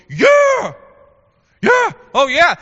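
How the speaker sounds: high, loud whoops of approval; crowd cheering. Acted. People of Israel hearing their enemies condemned, high loud cheering. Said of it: "you can just hear the people in Israel going".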